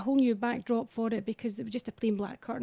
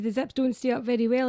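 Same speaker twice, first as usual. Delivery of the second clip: usual speaking voice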